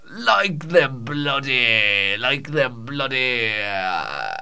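very slow, stretched Scottish words; drawn-out stalling. Pretend. Storyteller hunts for a comparison, stalling slowly. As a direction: drag each word out while searching.

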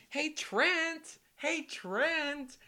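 high-pitched fan calling out a name; heckling call. Retold or imitated. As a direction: holler to get a player's attention. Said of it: "kept on yelling at the people".

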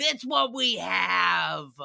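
very slow, stretched-out answer; emphatic drawl. Real, not acted. Host answers why Americans eat processed food, slowly emphatic. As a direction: drag out the punchline word by word.

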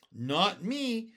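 loud, high, gleeful boast; happy cry. A narrator's reading. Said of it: "cried the centipede happily".

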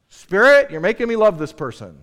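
loud cry, starting high; mock exclamation. Imagined believer exclaiming to the Spirit, loud mock outcry. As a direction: call out loudly, easing after the first word.

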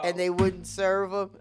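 laughing through the words; giggling as you tell it. Real, not acted. Laughing while telling a funny story.

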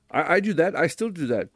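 normal talking voice

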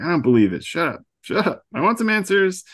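high, loud, rising frustrated outburst; hammed-up furious demand; upset teen snapping shut up; mock upset. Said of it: "she's really upset".

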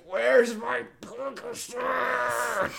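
high, loud, long drawn-out mock wail; agonized rage groan. Imitating friend after surgery hunting his pills, agonized groan. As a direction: groan it out slowly in mock agony. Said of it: "fucking groaning with the most agonizing perk rage".

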